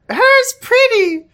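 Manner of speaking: put-on very high, slow toddler voice; adorable baby voice. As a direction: coo it slowly like a tiny girl. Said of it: "[name] says in her adorable baby voice".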